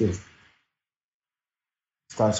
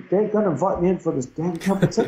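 impatient; 7 semitones higher, faster than usual. Retold or imitated. Impatient inner voice retold.

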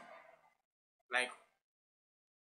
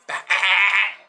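loud burst of laughter; 5 semitones higher, 6 dB louder than usual. Real, not acted. Laughs hard at the rhyme scheme.

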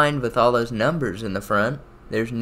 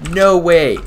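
real triumphant yelling, much higher and louder; excited shout; 9 semitones higher, 7 dB louder than usual. Real, not acted. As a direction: roar it like you just clutched the round. Clutched the round.

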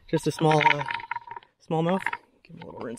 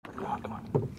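whispering; 6 dB quieter than usual. Real, not acted. Reeling in a fish.

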